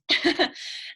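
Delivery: airy little laugh; breathy laugh. Real, not acted. Laughs at losing voice.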